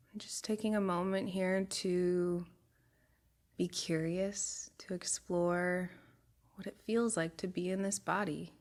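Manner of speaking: calm body-scan voice, long pauses between phrases; guided meditation. Real, not acted. Guide taking a group through a body scan, soothing meditation voice. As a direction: guide them unhurriedly, letting each phrase settle.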